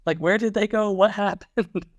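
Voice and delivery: high-pitched playful bewilderment; mock fan dismay. Fans wondering about their vanished niche band, mock dismay. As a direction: ask it high, as a baffled fan.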